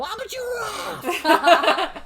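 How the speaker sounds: very high, loud, stretched-out shout; Vine imitation yell. Co-host quoting a favorite Vine, high drawn-out yell. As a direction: yell it high like the viral clip. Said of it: "my favorite Vine of all time".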